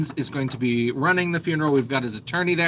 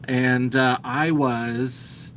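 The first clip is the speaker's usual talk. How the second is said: ordinary talk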